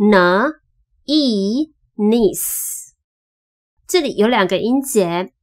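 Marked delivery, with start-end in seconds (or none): 0.1-2.7 slow n, long E, then niece; sounding out long E. Shown as a demonstration. Teacher demonstrating long E, sounding out niece. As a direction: blend n and a drawn-out E into niece. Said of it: "长母音的E".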